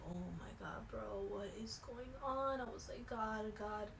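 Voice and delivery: soft, hushed pleading; whispered frightened prayer; quiet prayer. Retold or imitated. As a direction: plead under the breath. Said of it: "praying in my head".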